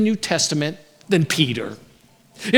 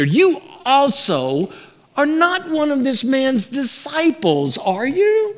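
put-on questioning servant girl; 5 semitones higher, slower than usual.

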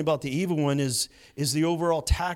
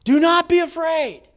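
ringing proclamation; 10 semitones higher, 7 dB louder than usual. Shown as a demonstration. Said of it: "four crucial words spoken by Jesus".

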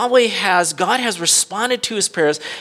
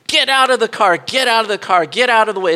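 mock shouted order; 5 semitones higher, 5 dB louder than usual. Carjacker orders boy out.

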